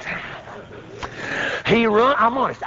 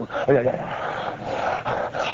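low, soft quivery muttering; quivering chin; 6 semitones lower, 7 dB quieter, much slower than usual. Retold or imitated. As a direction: jabber it with a trembling chin. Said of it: "my chin got to quiver".

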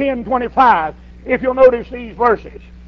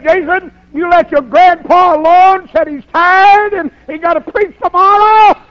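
raised, higher scolding dad voice; a father telling the boy off, louder; 5 semitones higher, 6 dB louder than usual. Retold or imitated.

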